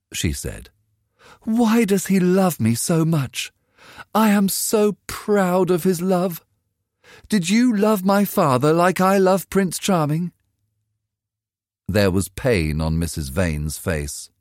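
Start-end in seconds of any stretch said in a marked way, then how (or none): 1.4-10.3 put-on a raised, eager girl's voice; a dreamy girl defending love over money; young girl Sybil. A narrator's reading. Daughter arguing with her mother, eager and raised.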